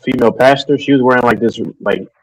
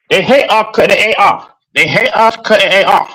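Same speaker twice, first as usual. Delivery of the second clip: mocking haters; 10 semitones higher, 3 dB louder than usual. Mocking haters' words, high voice.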